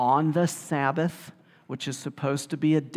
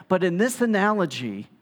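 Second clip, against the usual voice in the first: normal talking voice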